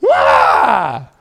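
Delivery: high, loud falling cry; mock wail. Reader upstaged by Craig, theatrical wail. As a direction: let out a hammed-up falling wail. Comic scream of despair.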